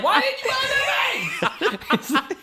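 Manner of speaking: high-pitched drawn-out yell, half laughing; comic screaming rebuke; mock scream. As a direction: scream it slowly, hammed up for laughs. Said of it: "Jesus screamed at me".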